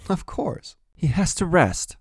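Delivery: mother answering, up high; mother's voice. A narrator's reading. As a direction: voice the mother, lifted in pitch. Mother's reply voiced in story.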